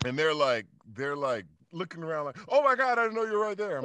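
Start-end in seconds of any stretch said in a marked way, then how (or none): 2.5-3.8 high, loud, fast starstruck gasp; starstruck fans. Retold or imitated. Fans realizing the actor sat beside them, high and fast. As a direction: rush it out like a stunned fan.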